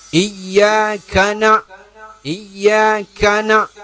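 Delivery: "iyyaka na-" drawn out very slowly, the k light; very slow Quran recitation of "iyyaka na-"; recitation example. Shown as a demonstration.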